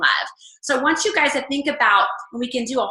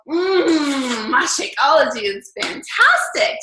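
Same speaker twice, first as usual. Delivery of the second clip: drawn-out, swooning mock praise for the shake; mock product kiss; 4 semitones higher, 4 dB louder, much slower than usual. Mocking coaches who kiss their product, slow swooning praise. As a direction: savor it like you're kissing the bag. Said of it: "Just don't do it".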